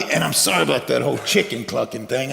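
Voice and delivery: low, sheepish aside; sheepish apology. Acted. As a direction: apologize awkwardly, dropping the voice. Peter apologizes for his denial.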